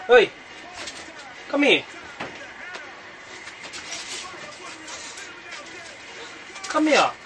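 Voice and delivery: high, insistent call; calling the dog. Real, not acted. Owner calling bulldog to come, high and insistent. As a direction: coax the dog over, firmly.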